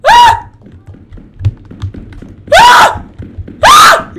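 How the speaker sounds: loud, very high screams of panic; short shrieks as you are chased; real high panicked shrieks; screaming. Real, not acted. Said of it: "I can't stop screaming".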